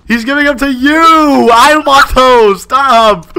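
high, loud whining protest; jealous, drawn-out loud complaint; indignant shouting. Real, not acted. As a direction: yell like you're being robbed. Protesting gifts going to girlfriend.

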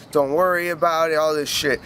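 ordinary talk

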